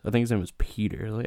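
normal talking voice

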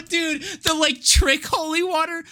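normal talking voice

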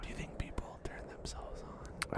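very soft whisper; quiet, voiceless ASMR murmur; playful ASMR whisper. Pretend. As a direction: join in with a hushed whisper.

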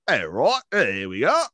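cartoon British catchphrases, rising; mock British accent. Host ignoring listeners' complaints, mock British accent. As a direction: answer back in a put-on British accent. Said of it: "stop with the accent".

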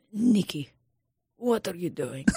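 put-on hushed Russian accent; Red. Red questioning Nikki, in a soft Russian accent. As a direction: speak softly with a Russian accent. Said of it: "the one Russian character".

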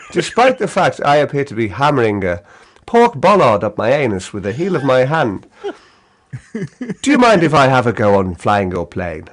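carefully enunciated British gentleman; politely measured British voice for absurd content; measured British enunciation. Shown as a demonstration. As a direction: put on an articulate, courteous British voice. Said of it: "enunciation to it and a few longer words".

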